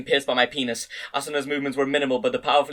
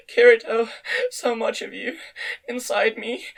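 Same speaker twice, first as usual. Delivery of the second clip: put-on a dazed young woman, barely holding on; thin high voice; 8 semitones higher, slower than usual. A narrator's reading. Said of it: "in a thin, high voice".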